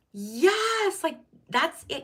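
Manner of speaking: a squealed, high, loud yes; excited yes. Retold or imitated. Reliving her credit score win, a high excited cheer. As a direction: burst out in delight. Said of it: "And I was like".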